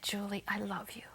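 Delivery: soft, whispered voice of love; hushed retold voice. Telling a host about a voice in the night, whispered. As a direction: breathe it gently, like a voice in the night. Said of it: "And that voice said".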